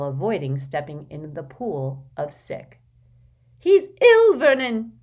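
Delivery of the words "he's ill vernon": high loud alarmed cry; panicked Aunt Petunia. Acted. Petunia cries out to husband.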